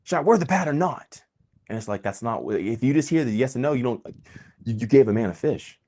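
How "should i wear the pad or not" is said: lightly voiced pleading questioner, pitched higher; hammed-up whiny ask, higher; a fan begging for a quick answer, mock pleading. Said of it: "people ask me".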